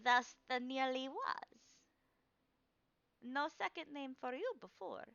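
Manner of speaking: lightly voiced old woman, cryptic; old crone seer. Crone to the player, cryptic and fading. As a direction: let it fall away mysteriously at the end.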